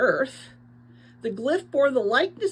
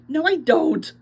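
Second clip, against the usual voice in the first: higher and louder, a voice unlike the narrator's; defensive denial; 6 semitones higher, 6 dB louder than usual. A narrator's reading. Teo objecting to Naya that he doesn't smell like the twins, loud. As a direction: protest it, pitched up and loud.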